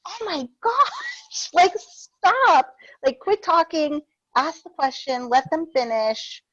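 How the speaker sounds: high, drawn-out exasperated plea; exasperated outburst. Retold or imitated. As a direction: scold mom like you've had enough. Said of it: "I'm like".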